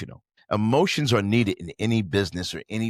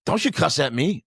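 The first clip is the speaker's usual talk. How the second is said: mock indignant manager; 7 semitones higher, 4 dB louder than usual. Manager's accusation retold.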